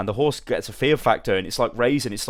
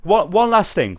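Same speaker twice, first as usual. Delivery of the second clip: ordinary delivery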